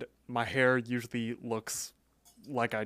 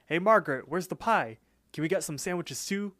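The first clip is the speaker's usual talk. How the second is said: higher-pitched imitated call for service; retold pestering shout-out, pitched up; 5 semitones higher than usual. Rigby runs up asking Margaret for pie, calling out.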